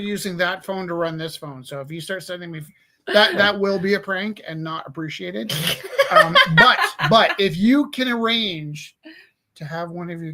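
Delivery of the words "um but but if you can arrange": words broken by laughter, slow; laughing. Real, not acted. Joking about cats phoning, laughing.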